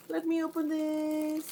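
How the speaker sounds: high, soft, drawn-out singsong; sing-song. Real, not acted. Vlogger opening the bag for viewers, sing-song.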